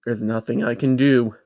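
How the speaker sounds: plain reading voice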